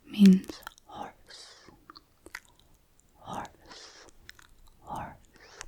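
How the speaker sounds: ordinary talk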